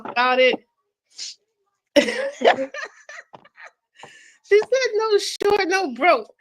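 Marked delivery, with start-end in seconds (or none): 2.0-6.3 high, laughter-squeezed retelling; cracking up. Real, not acted. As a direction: quote it while cracking up. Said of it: "I'm cracking up here".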